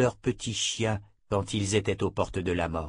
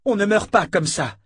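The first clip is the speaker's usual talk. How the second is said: the line again, higher and louder, near a shout; desperate cry; 10 semitones higher, 6 dB louder than usual. Acted. Richard refusing the death, crying out in despair. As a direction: push the denial into an anguished cry. Repeated denial, building.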